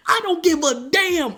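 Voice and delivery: loud, high, drawn-out outburst; defiant hype. Real, not acted. Vlogger defending a movie crush, loud defiant hype. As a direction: belt it out high and slow. Defiant gushing after laughing.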